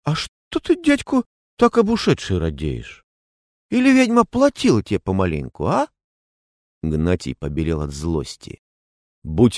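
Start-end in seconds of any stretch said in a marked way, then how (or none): 0.0-5.9 high, sneering taunt; mocking taunt. A narrator's reading. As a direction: needle him with a sneer. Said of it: "Тума хмыкнул".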